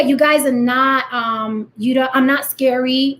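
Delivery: usual speaking voice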